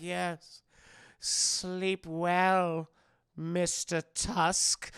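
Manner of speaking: slow, drawn-out villain farewell; creepy, measured goodnight; sinister host. Retold or imitated. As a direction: stretch the words out with creepy relish. Voicing Howard bidding his victim goodnight.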